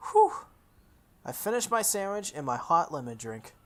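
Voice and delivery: ordinary delivery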